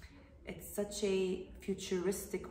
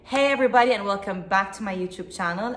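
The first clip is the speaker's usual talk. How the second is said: YouTube host intro; 3 semitones higher, 17 dB louder than usual. Acted. Host opening a YouTube video for viewers, presenter greeting. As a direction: greet the viewers at full volume, pitched up at the start.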